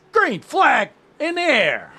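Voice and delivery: rally the crowd at the green flag; hyped race call. Acted. Race start hype call.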